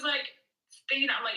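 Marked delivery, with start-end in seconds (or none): none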